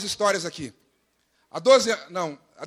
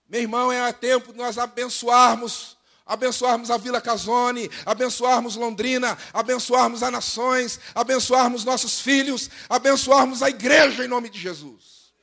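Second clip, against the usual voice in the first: high, driving litany building louder; full-force preaching; 5 semitones higher, 6 dB louder than usual. Real, not acted. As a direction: hammer out each blessing to the congregation.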